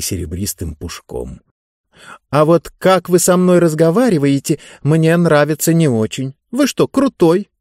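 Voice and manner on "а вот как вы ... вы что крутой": higher-pitched, pointed remark; lightly voiced girl standing up to a ruler; challenging girl. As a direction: challenge him with a slangy question.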